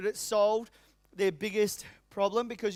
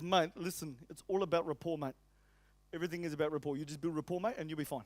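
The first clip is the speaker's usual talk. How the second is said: put-on Lebanese accent; 5 semitones lower, 6 dB quieter, faster than usual. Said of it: "That's exactly how he talked".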